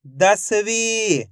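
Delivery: each syllable stretched out slowly; teacher slowly blends the syllables into a word for kids; slow blended word. Shown as a demonstration. Teacher to young pupils, blending syllables: slow, drawn out. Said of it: "तो मिलके बन जाएगा".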